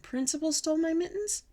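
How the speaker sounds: high rising disbelief; shocked question. A narrator's reading. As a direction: ask it as a scandalized child. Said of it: "very shocked".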